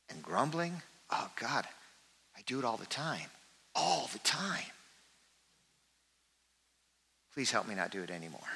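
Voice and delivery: soft, rueful admission of constant grumbling; hushed plea for help to stop; quiet contrite confession. Pretend. Congregant's voiced confession continued.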